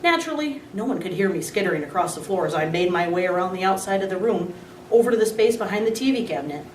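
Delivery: ordinary delivery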